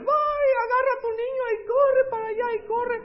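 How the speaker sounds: very high, loud panicked shriek; screaming women. Acted. Women fleeing the Egyptian army, shrieking in panic. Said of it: "todos temblando y gritando, y las mujeres".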